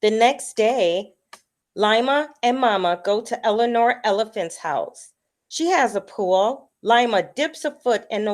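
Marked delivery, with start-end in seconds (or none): none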